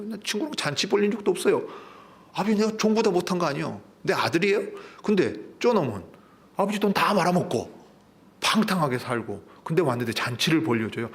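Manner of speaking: high-pitched, indignant complaint; angry protest. Acted. Elder son complains to father about the prodigal, acted indignation. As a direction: protest the unfairness, acting wronged. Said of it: "큰아들이 아직도 화가 안 풀어져요".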